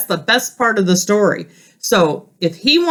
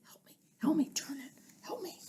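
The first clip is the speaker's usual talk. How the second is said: unvoiced soft whisper to the class; whispered aside; 5 semitones higher, 20 dB quieter, faster than usual. Real, not acted. Teacher asking the children quietly to help turn. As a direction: whisper a secret request. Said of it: "teacher whispers asides to class".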